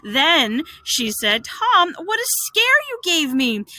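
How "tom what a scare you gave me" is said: high, emotional relief; relieved after scare. Acted. Aunt scolding the boy after a scare, relieved. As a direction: let the fright out in a raised voice. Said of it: "half laughing, half crying".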